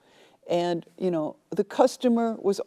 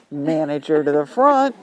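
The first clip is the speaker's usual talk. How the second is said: calling out; 4 semitones lower, 6 dB louder than usual. Retold or imitated. Clerk calls out again.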